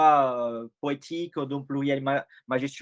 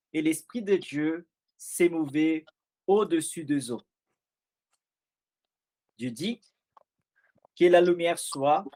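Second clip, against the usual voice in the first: scripture reading. Acted. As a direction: read Genesis aloud with measured pauses. Lecture solennelle de la Genèse. (lecture biblique solennelle)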